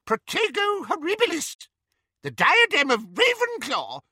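very high squeaky little voice; squeaky Flitwick. A narrator's reading. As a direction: squeak it like a tiny old wizard. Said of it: "squeaked Flitwick".

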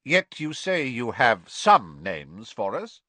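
lightly voiced stern magistrate; stern official. A narrator's reading. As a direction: press the prisoner firmly. Crouch presses the prisoner.

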